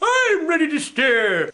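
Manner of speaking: slow cartoon bear with a swooping tune; green bear. Green bear reporting ready for battle, slow and drawn out. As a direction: stretch each word out slowly. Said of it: "Green's ready".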